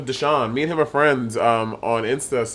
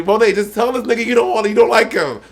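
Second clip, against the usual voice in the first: mimicking Bob's advice; 8 semitones higher than usual. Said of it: "You're gonna tell me".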